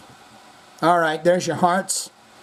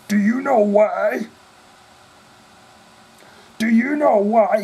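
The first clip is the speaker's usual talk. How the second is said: higher, teasing repeated question; 7 semitones higher, 4 dB louder than usual. Retold or imitated. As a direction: dangle the question like a rock-star tease. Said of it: "he does this".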